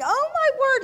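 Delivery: high, loud squeal of recollection; excited, high-pitched outburst; sudden realization. Real, not acted. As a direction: exclaim as the memory hits you. Sudden recollection while telling.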